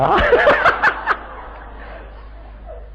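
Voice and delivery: breathy high laugh. Real, not acted. Preacher laughing at his own punchline. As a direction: laugh at your own joke.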